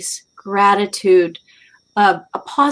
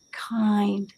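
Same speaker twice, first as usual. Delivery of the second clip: whisper; 8 dB quieter than usual. Real, not acted.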